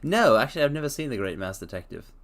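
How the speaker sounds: normal talking voice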